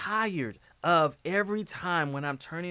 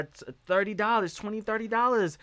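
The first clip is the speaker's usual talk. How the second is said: ordinary delivery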